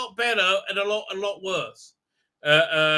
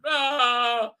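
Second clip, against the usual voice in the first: normal talking voice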